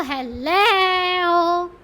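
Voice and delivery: hello drawn out very slowly; stretched out hello. Shown as a demonstration. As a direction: drag the word hello out long and slow. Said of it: "अल्फाज को ज़्यादा खींचना नहीं है".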